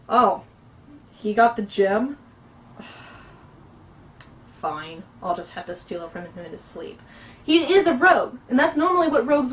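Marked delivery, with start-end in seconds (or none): 0.1-7.0 snide, sneaky grumble sinking low and soft; sigh, then give in quietly with a sneaky grudge; Buduk, passive-aggressive. Shown as a demonstration. Out-of-character rogue plotting to steal the gem, passive-aggressive. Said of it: "Out of character Buduk is".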